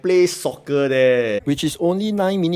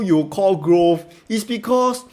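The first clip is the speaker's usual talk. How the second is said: mock dramatic; 4 semitones higher, much slower than usual. Mock reason for the name.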